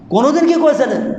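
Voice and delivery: usual speaking voice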